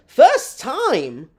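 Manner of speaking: loud, high incredulous echo. Real, not acted. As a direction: throw it back in loud, high-pitched disbelief. Incredulous echo of viewer's message.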